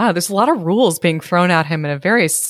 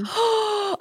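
excited gasp; 13 semitones higher, 6 dB quieter than usual. Real, not acted. Listener reacts to story twist.

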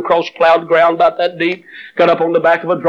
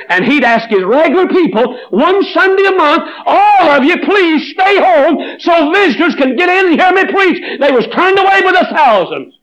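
high, loud pulpit cry; full-force preaching; 12 semitones higher, 3 dB louder than usual. Acted. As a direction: ring it out to the whole tabernacle. Preacher building to a peak.

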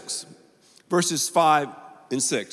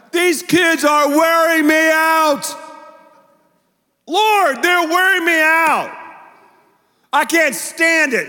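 much higher, louder, slow exasperated wail; spiritual temper tantrum; 10 semitones higher, 7 dB louder, slower than usual. Retold or imitated. Moses complaining to God about the people, a hammed-up loud tantrum. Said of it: "He's like".

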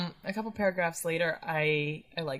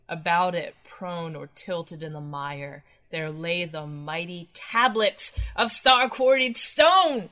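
slow, looming horror-story narration; dramatic reading; 5 dB louder, slower than usual. Acted. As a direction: read it slow and grand, rising to awe. Reads the story passage aloud.

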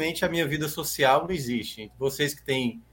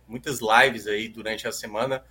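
ordinary delivery

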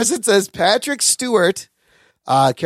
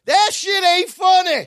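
"That shit ain't funny" shouted very high and slow from the back; heckler shout; 12 semitones higher than usual. Retold or imitated. Moviegoer heckling the screen from the back row, shouted high and slow.

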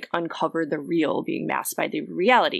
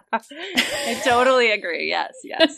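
higher, louder, laughing through the words; laughing agreement; 6 semitones higher, 5 dB louder than usual. Real, not acted. Laughing agreement with co-host's rant.

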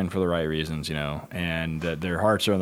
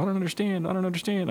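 whiny handlers; 12 semitones higher, faster than usual. Retold or imitated. Handlers complaining to their trainer about the leash, high mock whine. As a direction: imitate clueless handlers in a raised, high voice.

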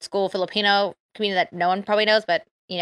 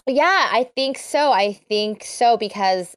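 slow, drawn-out musing; slower than usual. Real, not acted. Slowly mulling over the question.

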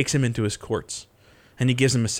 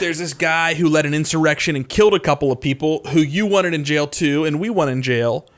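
higher, louder governor addressing a crowd; a hint of an official pleading his case; lightly voiced Pilate; 5 semitones higher, 4 dB louder than usual.

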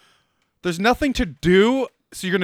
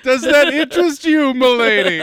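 mock gallant flirt; 5 semitones higher, 6 dB louder than usual.

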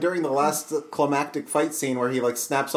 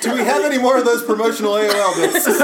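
shouting back; 6 semitones higher than usual. Pretend. Cop yelling back in bit.

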